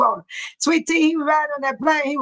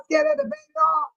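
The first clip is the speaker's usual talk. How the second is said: squeaky, much higher mock-scared question; high teasing question; 13 semitones higher, faster than usual. Real, not acted. Host teasing chat about baby oil, high squeaky tease. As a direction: tease them in a sudden high voice.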